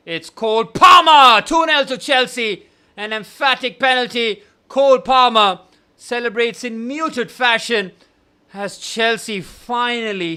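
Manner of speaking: loud, high shout of the goal; goal call shout. Acted. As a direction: roar the goal like a commentator.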